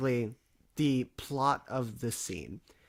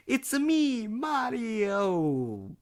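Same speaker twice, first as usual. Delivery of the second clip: Mario voice; 10 semitones higher, 4 dB louder, much slower than usual. Retold or imitated. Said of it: "And I'm like".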